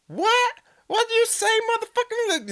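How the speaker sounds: high shrill mock of the woman, indignant; mock shrill lady. Mocking the rude lady who snaps back, high and shrill. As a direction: squeal it like the outraged lady. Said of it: "she's like".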